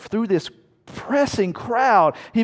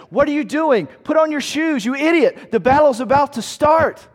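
loud, higher urgent yell; yelling at a soldier; 8 semitones higher, 5 dB louder than usual. Retold or imitated. Soldier yelling at comrade down the line, higher urgent shout. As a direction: shout at him to put his shoes on. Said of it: "you yell at them".

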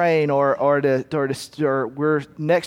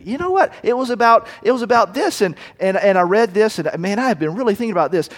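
higher-pitched, animated acted answer; eager reply; 4 semitones higher, faster than usual. Believer answering a friend about today's study, eager and excited. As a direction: burst in keenly, words tumbling out.

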